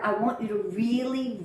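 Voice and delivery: ordinary delivery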